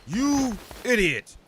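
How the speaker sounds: high, loud outburst; mock scolding shout. Leader yelling at a clumsy follower. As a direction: yell at the fool.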